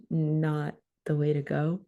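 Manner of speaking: ordinary talk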